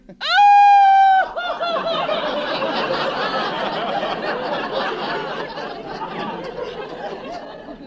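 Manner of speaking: long high loud wail, falling away; wife wailing as the family drives off; wailing cry. Acted. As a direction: sob it out without words in a high howl. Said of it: "stop that crying".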